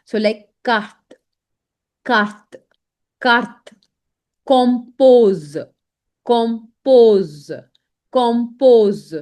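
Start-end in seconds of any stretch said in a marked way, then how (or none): 0.6-3.7 "carte" said KART with a hard K; C read as K. Shown as a demonstration. Teacher shows C without cédille, hard K in carte. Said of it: "you read it like K". 4.5-9.1 "compose" said kom-POZ in two drawn-out syllables; hard K and ending Z in "compose". Shown as a demonstration. Teacher demonstrates French word, slow kom-poz. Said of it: "so you read it like K".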